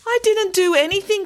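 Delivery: very high, pleading protest. A narrator's reading. Boy grabbed by the collar, protesting. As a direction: squeal your innocence. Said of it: "protested Tom, struggling to breathe".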